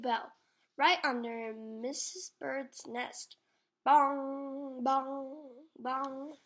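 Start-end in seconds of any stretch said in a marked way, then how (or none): none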